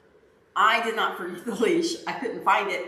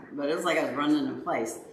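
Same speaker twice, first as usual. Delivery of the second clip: ordinary talk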